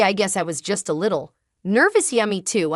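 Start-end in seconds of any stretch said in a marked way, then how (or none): none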